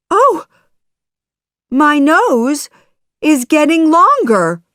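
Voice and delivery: high, loud storybook dismay; alarmed puppet. Acted. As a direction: cry out in alarmed surprise. Pinocchio's nose grows as he lies.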